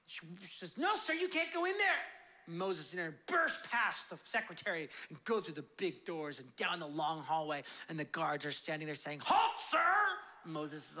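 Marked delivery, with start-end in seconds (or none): 0.8-2.0 higher-pitched mock protest; alarmed secretary. Secretary stops Moses bursting in, alarmed. 9.2-10.2 high, loud mock guard shout; guard's challenge. Palace guards challenge the intruders, shouting.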